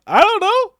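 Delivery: squeaky mock helplessness; mock Tim. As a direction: blurt it out helplessly, high. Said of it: "Tim's just like".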